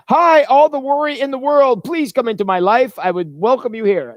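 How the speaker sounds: high, bright mock welcome to worry; welcoming worry in. Preacher mock-inviting all the world's worry inside, high cheery welcome. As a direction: throw the door open to worry like greeting a guest. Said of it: "opening the door to your life and saying".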